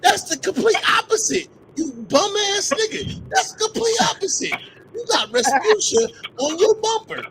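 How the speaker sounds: high, indignant protest; worked-up, high-pitched rebuttal; heated comeback. Real, not acted. Heated comeback to trash talk.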